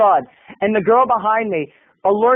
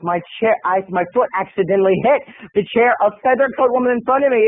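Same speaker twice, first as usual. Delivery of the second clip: ordinary talk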